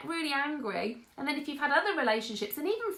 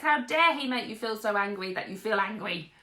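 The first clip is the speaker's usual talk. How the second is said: ordinary talk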